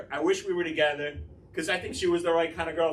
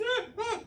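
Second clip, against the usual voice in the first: high falsetto moans, half laughing; squeaky faked moans; mock moaning; 3 dB quieter than usual. Mimics her moaning again.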